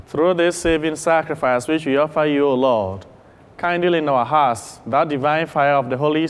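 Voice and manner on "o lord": normal talking voice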